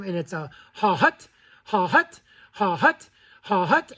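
two quick puffs, ha hut; clipped double cluck call; double goose cluck. Shown as a demonstration. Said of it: "On a double cluck".

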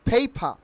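"paper" as phei-pha, silent r; corrected pronunciation. Shown as a demonstration. Teacher drilling learners, "paper" as phei-pha. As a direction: puff both p's in "paper", drop the r. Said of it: "Your correct pronunciation will be".

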